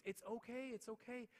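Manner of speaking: high, sugary reassurance; imitating sweet teacher. Teacher brushes off parent's apology, high mock-sweet voice. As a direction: soothe in a sing-song high voice, mock-sweet. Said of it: "she's made of rainbows and butterflies".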